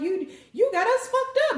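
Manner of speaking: high, mock-outraged crowd voice; indignant crowd. The people scoff at the Empress in a high outraged voice. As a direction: squeal it like an offended mob. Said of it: "The people was like".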